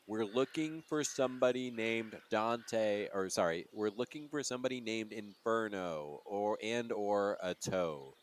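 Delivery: speaking very slowly as to someone hard of hearing; slow over-explaining. Acted. Player slowly asks the deaf old man for help. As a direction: spell it out, over-enunciated. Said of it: "I'm also signing for some reason".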